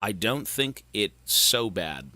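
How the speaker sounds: low and slow to spare the voice; low careful voice on a fried throat; speaking really low. Real, not acted. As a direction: keep it down low, slowly. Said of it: "if I speak really low".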